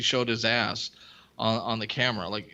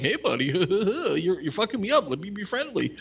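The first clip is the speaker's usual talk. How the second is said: mock friendly buddy voice; 8 semitones higher than usual. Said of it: "he's like, tries to sign up like".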